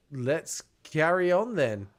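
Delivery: ordinary talk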